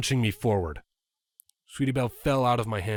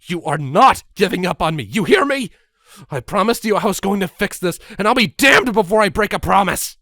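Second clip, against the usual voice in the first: loud, higher desperate vow; desperate shouting; 10 semitones higher, 10 dB louder than usual. Acted. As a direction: refuse to let her give up. Desperate plea to dying filly.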